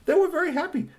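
very high, sing-song happy repeat; sing-song version of the line. Shown as a demonstration. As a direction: lift it up high with joy. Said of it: "the Tall Tale family was very happy".

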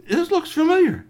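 high, loud exclamation of surprise; surprised recognition. Acted. Kwame spotting a small figure through the telescope, surprised. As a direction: exclaim it high, as if startled to know it.

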